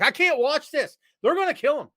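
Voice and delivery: put-on high, loud panicked child voice; imitating his younger self in mock panic; terrified kid. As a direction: cry it out like a scared kid watching the cage match. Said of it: "I'm like".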